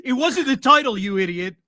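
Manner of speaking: can't stop laughing while talking. Real, not acted. Laughing while mocking the clip.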